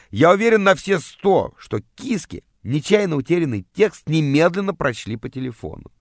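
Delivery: usual speaking voice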